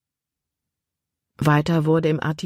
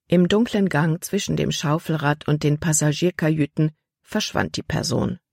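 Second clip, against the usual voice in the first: ordinary delivery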